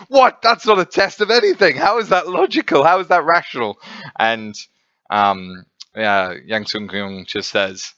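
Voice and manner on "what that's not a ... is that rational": high, loud outraged disbelief; hammed-up indignant outburst at the absurd test; losing it. Kuhn raging at the test's answer, high loud outrage. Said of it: "Kuhn loses it at this".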